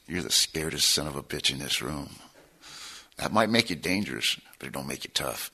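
low, soft, measured retold rebuke; quiet put-down. Old gangster to cocky newcomer, quiet low put-down. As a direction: imitate an old-timer calmly cutting someone down, voice dropping away.

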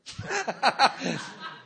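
real laugh. Real, not acted. Laughs at his own joke.

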